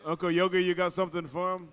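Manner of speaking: loud, high emcee call to the crowd; booming arena announcer; powwow announcer. Acted. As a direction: hype up the crowd for the little dancers. Powwow MC on the mic.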